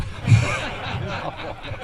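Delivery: a breathy, high, near-silent laugh; wheezy laugh. Real, not acted. Telling the K J Choi story to the crowd, quiet wheezy laugh. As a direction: crack up quietly at your own punchline.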